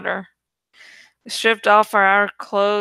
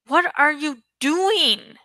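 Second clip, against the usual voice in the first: yelling; 6 semitones higher, slower than usual. A narrator's reading. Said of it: "Jake yelled".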